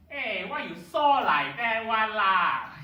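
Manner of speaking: loud, high, slow Manglish voice; Singlish. Retold or imitated. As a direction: belt it like a Malaysian auntie. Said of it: "It kinda sounds like".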